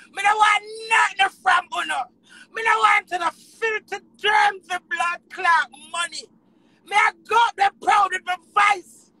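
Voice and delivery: high, forceful shouting; real angry refusal. Real, not acted. Artist refusing filthy money from rivals, angry. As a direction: throw the offer back, loud and indignant.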